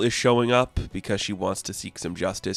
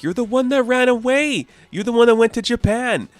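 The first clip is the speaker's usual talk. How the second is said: much higher, louder angry retort; brothers yelling back; 13 semitones higher, 5 dB louder than usual. Retold or imitated. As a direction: yell it back at him, accusing.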